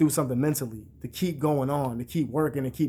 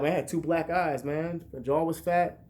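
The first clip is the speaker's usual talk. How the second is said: ordinary delivery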